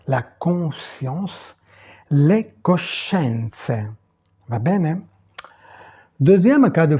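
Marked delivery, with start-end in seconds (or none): 2.1-3.9 "le coscienze" very slow and distinct; Italian pronunciation demo. Shown as a demonstration. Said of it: "prononcer les mots". (prononcer « le coscienze » très lentement, à l'italienne)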